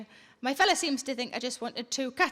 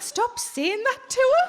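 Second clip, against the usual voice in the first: high, louder, slow protest; hammed-up high telling-off; mock exasperated; 9 semitones higher, 5 dB louder, slower than usual. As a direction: whine it at him, drawn out. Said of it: "I said".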